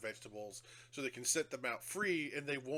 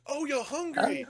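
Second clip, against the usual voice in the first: vicar voice; 12 semitones higher, 7 dB louder, slower than usual. Retold or imitated. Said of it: "hear the creature go".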